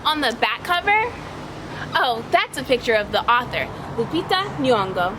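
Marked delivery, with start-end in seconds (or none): none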